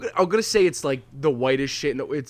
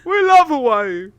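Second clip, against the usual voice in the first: high, slow cartoon squeal; goofy cartoon voice; 10 semitones higher, 5 dB louder, slower than usual. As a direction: drag it out like a silly cartoon kid. Still in the Ed voice.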